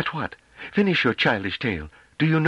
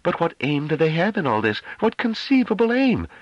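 high, pressing demand. A narrator's reading. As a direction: push for an answer, slowing. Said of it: "demanded Dall'Army".

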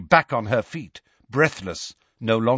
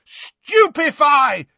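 loud, high, stretched spell cry; explosive high-pitched shout; yelled; 13 semitones higher, 5 dB louder, much slower than usual. A narrator's reading. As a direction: yell the spell with all your force. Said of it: "yelled Harry".

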